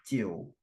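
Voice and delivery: Jiu said only low, no rise; a short low Jiu; low third tone. Shown as a demonstration. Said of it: "jiunya juga Lause rendah doang".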